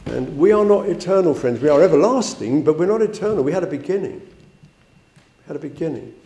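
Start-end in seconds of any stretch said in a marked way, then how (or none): 4.2-6.1 repeated softly; quiet echo. Shown as a demonstration. Preacher to friends, a soft quiet echo. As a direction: let it sink in quietly. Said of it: "repeated softer".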